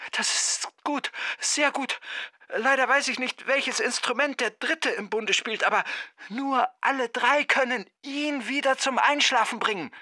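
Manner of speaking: very high, soft voice on the line; distant phone caller. A narrator's reading. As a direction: explain urgently from the other end of the phone. (hohe, leise, ferne Telefonstimme)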